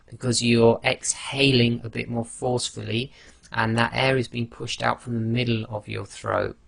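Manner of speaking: normal talking voice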